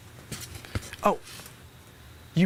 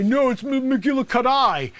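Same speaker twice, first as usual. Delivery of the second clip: mimicking the player; 3 semitones higher than usual. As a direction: mimic the kid's correction slightly slowed. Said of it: "when I'm asking your name and you say".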